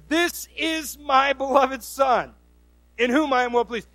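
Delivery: God's booming voice. Acted. Preacher voices God announcing his Son at the baptism, big booming voice. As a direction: boom it out slowly from heaven. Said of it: "a big booming voice".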